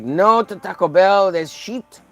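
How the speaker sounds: hammed-up fussy gourmet friend; snooty Fred impression. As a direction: play the food snob who sneers at tacos. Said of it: "He's like".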